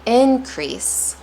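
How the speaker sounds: Increase said IN-crease, IN pitched high; stress on first syllable. Shown as a demonstration. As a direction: punch the IN of Increase. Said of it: "going to say it differently".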